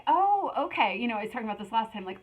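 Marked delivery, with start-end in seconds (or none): 0.1-1.0 mock surprised realization, higher; half-acted dawning surprise; surprised oh okay. Retelling her own realization about the helpers, mock surprised. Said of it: "And I was like".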